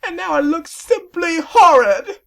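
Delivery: very high, slow falsetto whine; a vain sea serpent sulking over his lost mustache; high, put-on whimpering voice; weepy dandy serpent. Serpent laments.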